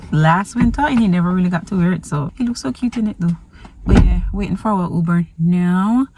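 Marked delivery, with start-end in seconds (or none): none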